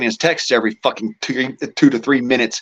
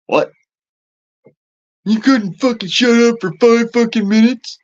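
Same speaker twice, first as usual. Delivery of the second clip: mock exasperated snap; 10 semitones higher than usual. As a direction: fire back with exaggerated annoyance, pitched way up. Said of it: "I'm like".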